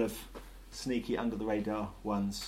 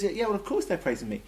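offhand reply, pitched higher; Jesus; 8 semitones higher than usual. Acted. Jesus answering the indignant priests, matter-of-fact. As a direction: toss off "of course" quickly and high. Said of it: "Jesus says".

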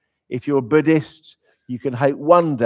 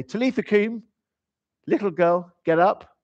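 put-on higher, sing-song call of "Talitha koum" like waking a child for school; waking a daughter; 8 semitones higher than usual. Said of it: "go Aramaic and go".

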